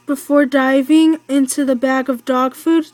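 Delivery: ordinary delivery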